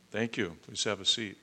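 ordinary talk, nothing marked

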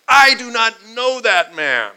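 loud, high, furious denial; angry shout. A narrator's reading. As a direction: roar it out in anger. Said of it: "began to curse and swear".

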